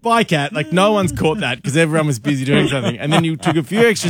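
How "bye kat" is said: cheery goodbye. Retold or imitated. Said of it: "And I was like".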